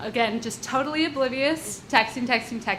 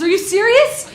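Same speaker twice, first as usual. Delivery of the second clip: much higher and louder are you serious; incredulous; 10 semitones higher, 9 dB louder than usual. Retold or imitated. The mugger finding one dollar, loud incredulous. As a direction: blurt it in pure disbelief. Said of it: "his turn to be incredulous".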